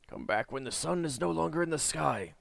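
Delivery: put-on croaky old voice at the door; croaking. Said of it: "croaked the voice".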